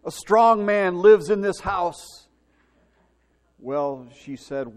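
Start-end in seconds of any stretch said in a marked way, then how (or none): 0.0-2.3 slow, loud, higher mock-tough voice; shouted warning. Boy warning robbers from the porch, slow loud shout. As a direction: puff up and warn the robbers. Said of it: "what do you mean by shouting".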